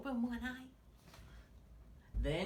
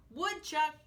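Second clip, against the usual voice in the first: higher, louder call across the hill; 7 semitones higher, 6 dB louder than usual. A narrator's reading. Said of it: "called Turtle".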